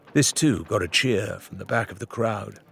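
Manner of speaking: ordinary delivery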